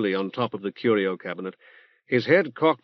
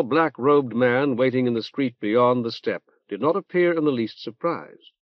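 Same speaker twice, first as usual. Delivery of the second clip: plain reading voice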